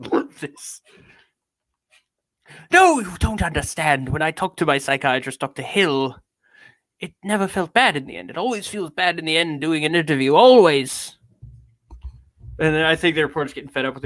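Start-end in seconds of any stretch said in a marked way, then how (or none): 2.7-11.2 theatrical British protest; hammed-up British self-pity; British accent. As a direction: insist grandly in an affected British voice. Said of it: "I'm going back to British now".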